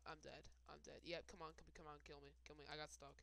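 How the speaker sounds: ordinary talk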